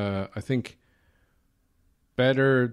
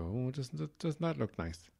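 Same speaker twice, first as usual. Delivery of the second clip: mock admiring; 4 semitones higher, 9 dB quieter than usual. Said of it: "look at all the words and go".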